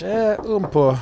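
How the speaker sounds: put-on playful French accent for a little. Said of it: "Do you speak French?".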